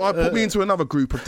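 ordinary delivery

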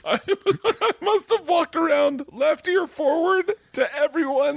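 high pitched, slow, laughing through the words; 웃음이 터진 채 말하기. Real, not acted. Laughing while telling earring story.

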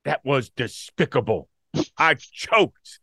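emphatic mock confession; imitating Stephen A. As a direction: punch out each phrase, lightly imitating him. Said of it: "He's like".

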